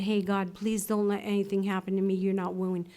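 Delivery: soft, hushed prayer under the breath; quietly pleading with God, barely voiced; still small voice. Praying to God in a small voice, hushed and soft. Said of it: "the still small voice".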